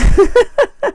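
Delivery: high, bright burst of giggling; giggle. Real, not acted. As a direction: laugh at yourself. Laughing at herself.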